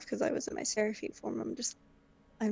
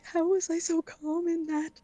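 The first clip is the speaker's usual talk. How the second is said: high, slow, louder disbelieving thought; acting a rattled character thinking aloud; pitched-up, drawn-out bewilderment; shaken up; 8 semitones higher, 4 dB louder than usual. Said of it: "like, shooken up".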